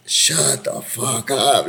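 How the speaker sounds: slow, stretched-out shut the fuck up; slow, awed you-can't-be-serious; amazed disbelief. Retold or imitated. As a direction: let the disbelief draw every word out. Reacting to surprise movie offer.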